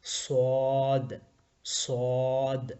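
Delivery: صاد said heavy and thick, vowel held long and slow; heavy, drawn out. Shown as a demonstration. Teacher to class, reading green letter صاد heavy. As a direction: thicken صاد and stretch it slowly. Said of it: "اس کو ہم موٹا کر کے پڑھیں گے".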